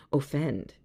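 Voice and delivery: normal talking voice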